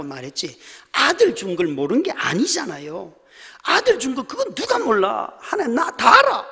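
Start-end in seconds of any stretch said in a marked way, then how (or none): 3.6-6.5 high, loud furious cry; shouting at God. Retold or imitated. The pastor screams at God in grief, high and loud. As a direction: yell it back in anguish. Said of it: "악을 쓰고 막 울었어요".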